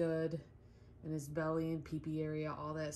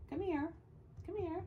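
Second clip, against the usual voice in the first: much higher, sing-song call to the dog; high coaxing call; 10 semitones higher, 6 dB quieter than usual. Real, not acted. Owner calling her dog over in a high coaxing voice. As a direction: coax him over in a high voice.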